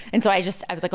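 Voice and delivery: normal talking voice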